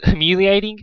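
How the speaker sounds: plain reading voice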